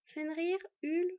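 ordinary delivery, nothing marked

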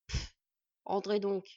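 huff, then a loud grudging invitation; grumpy surrender. A narrator's reading. Dwarf letting visitors in, huffed and grudging. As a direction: puff and give in grumpily. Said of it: "capitula le petit être ronchon".

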